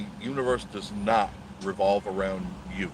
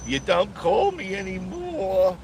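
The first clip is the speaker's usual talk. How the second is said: high, slow pouting whine; mock whiny complaint; 10 semitones higher, slower than usual. Teasing his friend Tommy for not calling, in a high mock whine.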